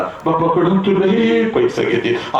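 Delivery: ordinary talk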